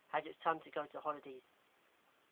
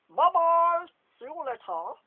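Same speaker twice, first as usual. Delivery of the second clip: loud high sing-song goodbye; cheery goodbye; 13 semitones higher, 16 dB louder, slower than usual. Acted. Lockhart leaving the common room, loud high goodbye. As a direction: toss a bright farewell.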